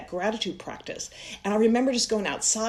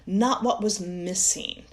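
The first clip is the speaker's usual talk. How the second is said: ordinary delivery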